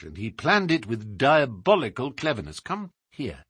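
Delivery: measured, emphatic sleuth laying out a case; animated detective monologue, above the narrator's pitch; explaining detective. Acted. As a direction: voice Holmes expounding with assurance. Holmes explains the crime.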